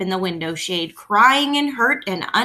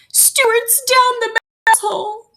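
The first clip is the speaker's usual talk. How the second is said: high, slow, fretful mother voice; distressed mother; 14 semitones higher, slower than usual. A narrator's reading. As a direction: break the news in a worried rush of feeling. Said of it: "she cried".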